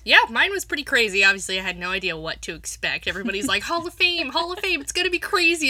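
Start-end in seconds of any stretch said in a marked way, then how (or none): 3.6-5.7 high-pitched imitation of excited chatter; mimicked hype. Co-host imitating everybody hyping the event, excited. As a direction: hype it up like everyone around you.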